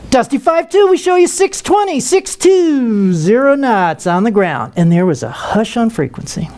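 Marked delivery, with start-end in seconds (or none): 0.1-4.7 gleeful singsong controller read, slow on the numbers; controller with a little glee. Retold or imitated. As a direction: deliver the speed like you just won. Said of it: "a little glee in the controller's voice".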